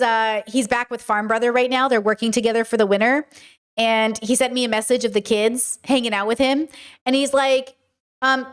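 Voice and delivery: ordinary delivery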